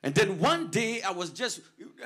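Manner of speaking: loud, building preacher climax; preaching at full force. Real, not acted. Pastor proclaiming God's faithfulness, loud climactic preaching. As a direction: lift the church with a loud proclamation.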